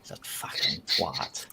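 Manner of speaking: slow, breathy whispered insult. Real, not acted. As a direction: hiss it under the breath, slow. Insulting a friend in chat.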